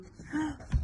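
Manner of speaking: big storyteller gasp of surprise; dramatic gasp. Acted. Reader gasps at the big bear. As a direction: gasp at the size of it.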